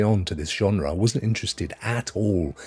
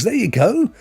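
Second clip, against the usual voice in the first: ordinary delivery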